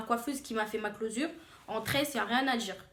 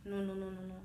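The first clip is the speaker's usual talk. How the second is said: ordinary talk, nothing marked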